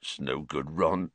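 quiet defeated sorrow; sad. A narrator's reading. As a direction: let the words sink away. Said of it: "said Hagrid sadly".

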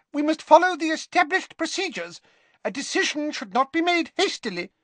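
very high squeaky little professor; Flitwick. Acted. Flitwick cautioning the staff on procedure, squeaky little voice. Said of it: "in his squeaky little voice".

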